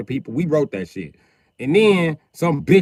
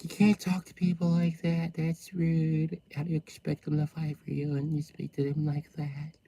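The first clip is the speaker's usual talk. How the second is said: prim complainer; 10 dB quieter than usual. Acted. Voicing prim Capricorn complainer.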